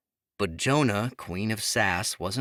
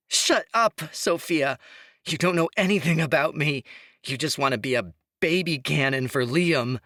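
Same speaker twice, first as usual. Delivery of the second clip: screeched far higher and louder, then fast; screeching; 8 semitones higher, 5 dB louder than usual. A narrator's reading. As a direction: lose control, screeching at her friend. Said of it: "Imogen's voice rose to a screech".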